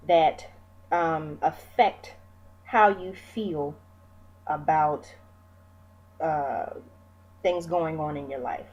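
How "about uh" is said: ordinary delivery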